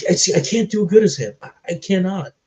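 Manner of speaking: high, fast, emotional rush of words; on the verge of tears; choked up. Real, not acted. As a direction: voice rising with feeling. Choked up describing the scene.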